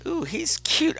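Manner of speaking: high, soft little swoon; cooing aside. Retold or imitated. Theatergoer spotting a cute actor, hushed coo. Retold aside during the show.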